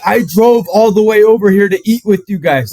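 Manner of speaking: high indignant protest. Retold or imitated. Grandson protesting to grandparents he drove there, high and loud. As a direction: plead loudly and outraged.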